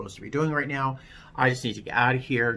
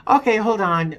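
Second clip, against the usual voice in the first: brisk operator; 9 semitones higher, 8 dB louder than usual. Retold or imitated. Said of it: "phone operator replies".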